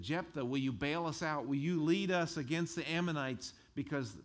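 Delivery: loud, high, fast begging; loud, high pleading from a desperate crowd; desperate plea. Retold or imitated. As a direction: beg urgently for rescue. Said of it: "went to Jephthah and said".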